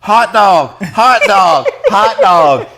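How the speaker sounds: put-on high, loud laughing kid voice chanting; a little kid begging for hot dogs, squeaky and giggly; squealing kid. Kid begs parents for hot dogs, high laughing squeal.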